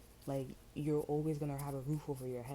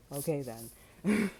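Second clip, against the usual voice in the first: sarcastic okay then; 7 dB louder, much slower than usual. Real, not acted. Sarcastic slow retort.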